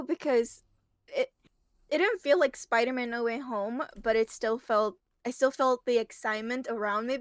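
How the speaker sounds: ordinary talk, nothing marked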